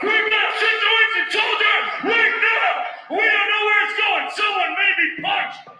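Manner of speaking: loud, high barked orders; drill sergeant. Retold or imitated. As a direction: wake the soldier up with a shout.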